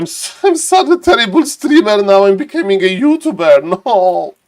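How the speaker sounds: laughing self-deprecation; laughing at himself. Real, not acted. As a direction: giggle out the self-mockery. Laughing at himself while streaming.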